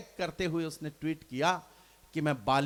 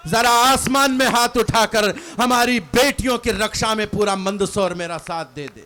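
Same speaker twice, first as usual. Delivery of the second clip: much louder call to the whole crowd; rally the audience, voice raised; rousing appeal; 5 semitones higher, 14 dB louder than usual. Real, not acted.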